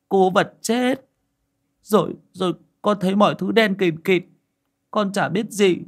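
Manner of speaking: put-on high, frightened child's voice; stammering boy. A narrator's reading. Scared boy reporting the ghost woman, high stammering voice. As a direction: stammer it out in halting phrases. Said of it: "Bác Bình lắp bắp".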